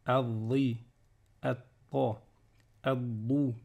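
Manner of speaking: "adri", "atta", "adou" with the middle consonant held; doubled consonant. Shown as a demonstration. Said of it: "on va doubler la lettre d'après".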